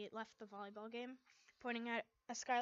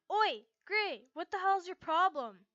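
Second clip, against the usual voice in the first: loud, high angry snap; snapping angrily; 7 semitones higher, 12 dB louder than usual. A narrator's reading. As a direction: bite his head off. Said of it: "I snapped at him".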